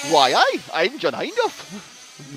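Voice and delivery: very slow, drawn-out mock Geordie with a Scottish twang; Geordie accent attempt. Geordie accent, commentator mimicking his co-host's name. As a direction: Geordie accent slipping into Highland Scots. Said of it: "tiny bit of the Highlands twang out of it".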